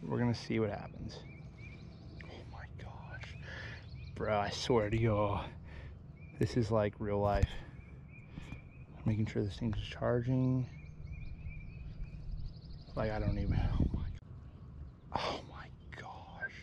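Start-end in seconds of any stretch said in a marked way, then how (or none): 2.2-3.3 breathy, unvoiced "oh my gosh"; awestruck whisper. Real, not acted. Angler spotting a giant bass, awestruck whisper. 13.7-16.5 awestruck whisper. Real, not acted. Hushed awe at the giant bass.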